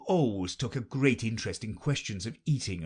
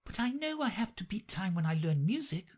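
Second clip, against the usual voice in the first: cautious Alice; 11 semitones higher than usual. A narrator's reading. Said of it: "Alice cautiously replied".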